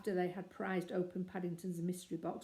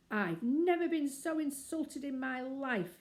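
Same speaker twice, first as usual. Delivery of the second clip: slow, loud indignant outrage; 7 semitones higher, 5 dB louder, slower than usual. A narrator's reading. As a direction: huff out the insult. Curry purple in the face.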